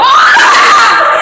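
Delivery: sudden frightened yelp; startled scream. Real, not acted. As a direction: shriek in real fright.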